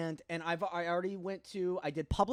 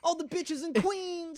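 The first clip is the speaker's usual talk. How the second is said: high-pitched hammed-up New York accent; 9 semitones higher than usual. Shouting out the boroughs, high-pitched New York accent.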